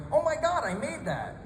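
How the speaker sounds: lightly voiced tourist, high gasp on oh my God; a touch of an awestruck tourist; Chinese tourist impression. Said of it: "Chinese tourists walk around going".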